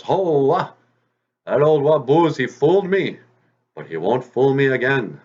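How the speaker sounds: high, loud outcry of a tricked fox; indignant fox. Acted. Fox finds only empty moccasins in the snow, indignant. Fooled fox exclaims in the story.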